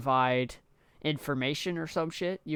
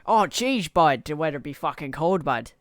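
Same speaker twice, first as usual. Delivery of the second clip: mock Canadian accent; 7 dB louder than usual. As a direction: exaggerate a Canadian accent, loud and raised. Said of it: "We don't go".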